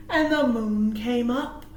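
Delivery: plain reading voice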